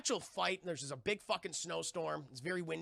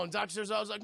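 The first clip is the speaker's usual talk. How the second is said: ordinary delivery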